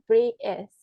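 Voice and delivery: "British" in a British accent, the t left silent. Shown as a demonstration. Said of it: "pakai aksen ... Britishnya tuh dibacanya". ("British" beraksen Inggris, huruf t tidak dibunyikan)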